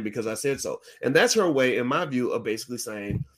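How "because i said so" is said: low, quieter, final mom line; mother ending the argument. Retold or imitated. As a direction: end the debate, low and firm. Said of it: "ends the argument by saying".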